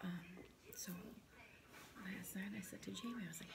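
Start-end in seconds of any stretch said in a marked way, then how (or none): 2.1-3.5 whispering. Real, not acted. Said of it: "I have to whisper".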